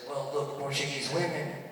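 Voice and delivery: put-on a hushed old man confiding; grandpa voice. Grandpa whispers his secret to his grandson, low and soft. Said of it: "he goes".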